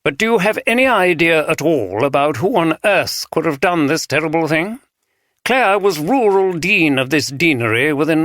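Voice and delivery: crisp clipped-free precise RP English delivery; precise RP accent. A narrator's reading. Archdeacon questions the chief inspector, precise RP English accent. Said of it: "speaking very precisely in a refined R P accent".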